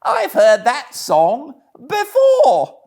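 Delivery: laughing. A narrator's reading. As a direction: gloat over your shoulder as you gallop off. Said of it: "said Toad, laughing".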